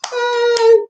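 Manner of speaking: a high, bright hey calling out goodbye; mock farewell call. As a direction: sing out a breezy hey as she walks off. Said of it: "they can wake up ... they say".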